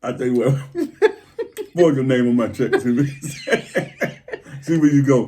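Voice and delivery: laughing through the words; spluttering with laughter. Real, not acted. Laughing mock threat to wife.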